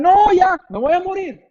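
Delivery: high, whiny panic about dying; panicked patient. Retold or imitated. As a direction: pitch it up like a scared hypochondriac. Sick man panics over diagnosis. (paciente asustado, voz aguda y quejumbrosa)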